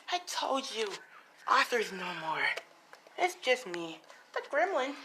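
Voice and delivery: high, crazed villain voice; theatrical Gremlin villain. Acted. Gremlin telling Deku Arthur's gone, theatrical villain. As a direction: gloat in a high gremlin pitch.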